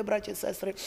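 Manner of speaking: ordinary talk, nothing marked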